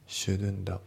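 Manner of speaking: "shouldn't the" with the T dropped. Shown as a demonstration. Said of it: "Tが抜けますね".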